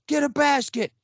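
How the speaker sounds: very high, frantic call; squeaky-high retold command; urgent excited order.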